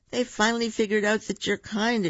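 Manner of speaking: high, excited exclamation; exclaimed. A narrator's reading. Koko tells Bear the animals finally understand, high exclamation. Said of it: "exclaimed Koko".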